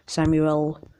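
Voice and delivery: ordinary delivery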